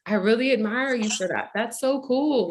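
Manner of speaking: mildly gushing retold praise; admiring stranger. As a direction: imitate a stranger who is impressed. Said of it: "And she was like".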